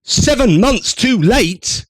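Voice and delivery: high, slow, exasperated retort; indignant. Real, not acted. Commentator answering a clip, slow and exasperated. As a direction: spit it back in disbelief.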